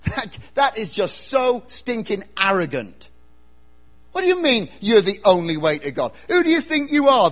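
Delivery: loud outrage, climbing in pitch; indignant challenge. Acted. Sceptic challenging Jesus' claim to be the way, indignant. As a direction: build to a high, loud demand.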